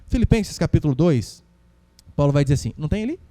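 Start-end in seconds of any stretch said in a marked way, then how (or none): none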